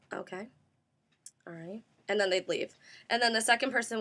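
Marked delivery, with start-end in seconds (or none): 0.1-1.8 soft, low, noncommittal reply; unimpressed acknowledgement. Retold or imitated. Interviewer to weak candidate, quiet unimpressed acknowledgement. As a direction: drop it right down, barely interested. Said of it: "And she would say".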